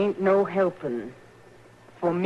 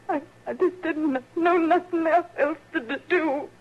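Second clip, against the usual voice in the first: breaking into tears; 9 semitones higher than usual. Acted. Grieving mother breaks down.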